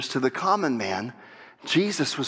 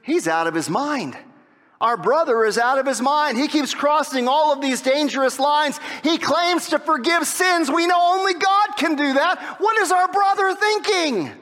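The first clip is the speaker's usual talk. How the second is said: worried family; 11 semitones higher than usual. Retold or imitated. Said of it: "Instead, they concluded".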